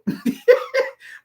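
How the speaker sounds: short high burst of laughter. Real, not acted. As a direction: laugh at your own joke. Laughs after buy the book.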